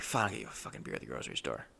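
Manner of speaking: very low, fast, soft grumble; grudging grumble. Retold or imitated. Customer agreeing to buy the tattooist beer, grudgingly muttering. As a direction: rush it out low and resentful.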